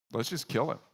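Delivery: ordinary talk, nothing marked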